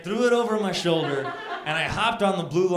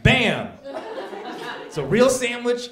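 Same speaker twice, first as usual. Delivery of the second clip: higher and louder triumphant presentation; triumphant bam; 7 semitones higher, 3 dB louder than usual. Retold or imitated. Comedian proudly handing the homeless guy a sandwich. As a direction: slap it down like a prize.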